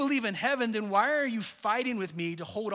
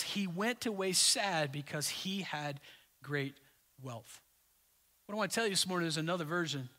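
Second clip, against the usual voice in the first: ordinary delivery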